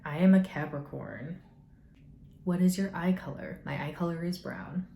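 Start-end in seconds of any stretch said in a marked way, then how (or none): none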